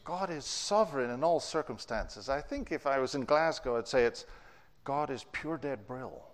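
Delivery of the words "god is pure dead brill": slow, soft Glaswegian Scottish accent; a light Glaswegian accent, slow. Shown as a demonstration. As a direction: say it like a Glasgow local, Glaswegian accent. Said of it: "said with a Scottish accent or Glaswegian accent".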